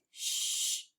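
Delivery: soft unvoiced shh for each rest; shushing rests. Shown as a demonstration. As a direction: hush each rest out softly. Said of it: "we shh".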